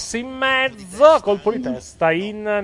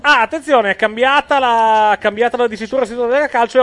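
excited alert; 5 semitones higher, 6 dB louder than usual. Real, not acted. Live alert on score change.

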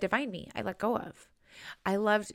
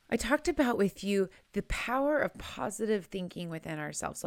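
usual speaking voice

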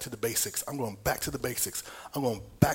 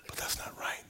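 soft, breathy whispered aside; whispering; 7 dB quieter than usual. Real, not acted. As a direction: drop to a whisper. Quiet aside in sermon.

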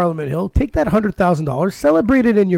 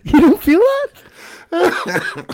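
incredulous; 9 semitones higher, slower than usual. Real, not acted. Ranting podcaster to co-host, high incredulous disbelief. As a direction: beg them to see it, laughing.

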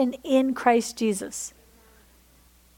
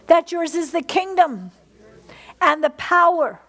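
prayer. Acted. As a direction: pray it reverently, unhurried. Closing prayer to God.

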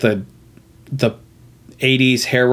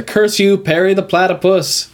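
higher-pitched mock villain curse; defeated villain's catchphrase; 7 semitones higher than usual. Recapping the show's villain losing to Perry, mock-thwarted cry. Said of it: "when he loses".

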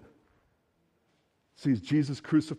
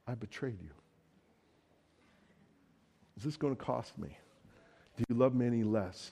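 low, much softer, guilty plea; ashamed Peter; 6 semitones lower, 11 dB quieter than usual. Retold or imitated. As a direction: confess it barely above a breath. Peter confessing betrayal.

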